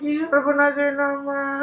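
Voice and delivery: slow, high tearful wail; weeping lament; crying. Acted. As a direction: draw the words out through sobs. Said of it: "তুমি কান্নাকাটি করতেছো কেন".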